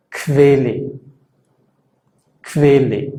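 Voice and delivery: kvele: la qu suena kv, sin u; pronunciación alemana. Shown as a demonstration. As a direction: di kvele con kv inicial. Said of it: "y se pronuncia kve". (Quelle pronunciado kvele)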